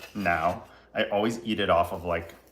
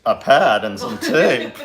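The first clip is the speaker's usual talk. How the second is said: much louder, slow incredulous repeat; surprised echo; 11 dB louder, slower than usual. Real, not acted. To the helper offering a pad and tape, surprised. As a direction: echo it back in disbelief. Echoes helper's odd suggestion.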